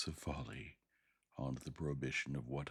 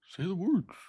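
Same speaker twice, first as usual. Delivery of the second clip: much higher, louder shrill-free cry; ape man urging; 10 dB louder than usual. A narrator's reading. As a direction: press him to speak. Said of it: "said the ape-man, repeating".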